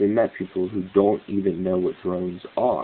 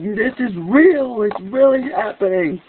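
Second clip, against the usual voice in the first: mock frantic shout, much higher and louder; frantic yell; 13 semitones higher, 4 dB louder than usual. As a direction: hammer out real slowly, as if nobody will listen. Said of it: "And I'm like".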